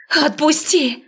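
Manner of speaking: shrill-pitched scream of let go; shrieking. Acted. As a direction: shriek it out, struggling. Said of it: "заверещала я".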